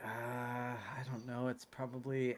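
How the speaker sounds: slow, quiet drawn-out hesitation, lightly acted; pondering. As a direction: imitate someone slowly working out an answer. Old man pondering the time.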